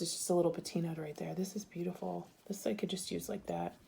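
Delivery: ordinary delivery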